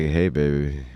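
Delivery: low, soft crooning come-on; smooth pickup line. Retold or imitated. Mock pickup line to a woman.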